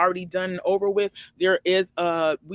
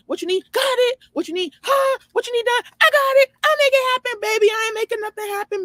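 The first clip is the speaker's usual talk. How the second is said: high, bright always-available helper; eager yes-woman voice; 5 dB louder than usual. Retold or imitated. Imitating her old always-available self, high and eager. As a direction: chirp it like you'll do anything. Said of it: "just being like".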